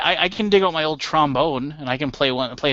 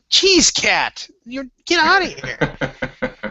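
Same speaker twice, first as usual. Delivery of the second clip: mock dismissive; 8 semitones higher, much slower than usual.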